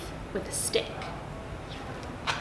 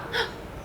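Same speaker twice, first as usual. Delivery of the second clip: gasp; 9 semitones higher, 9 dB quieter than usual. Acted. Crocodile's pain suddenly gone.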